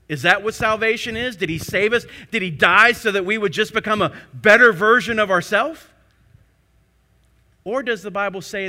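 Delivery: loud, high, rising questions; full-force preaching. Acted. Pastor challenging believers about salvation, loud preaching. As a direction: challenge them, swelling up.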